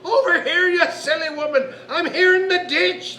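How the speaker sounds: high, loud squeaky little man; bad-tempered squeaky yell. A narrator's reading. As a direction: squeak an irritated call from the ditch. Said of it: "said the squeaky voice".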